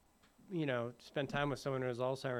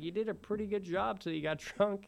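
quoting the boss, laughing; 9 semitones higher, 3 dB louder than usual. Retold or imitated. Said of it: "he's like".

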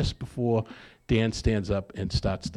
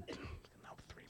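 hushed whisper, just between you and the bartender; whispered order; 24 dB quieter, slower than usual. Retold or imitated. Drinker whispering his order to the bartender, hushed. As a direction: whisper the order to the barman, slow at first. Alcoholic quietly orders at bar.

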